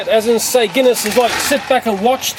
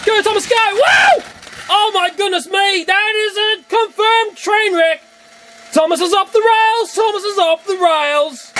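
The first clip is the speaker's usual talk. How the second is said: much higher, fast excited crash commentary; 10 semitones higher than usual. Real, not acted. Narrating toy train crashes, excited high commentary. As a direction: cheer on the wreck like a race caller.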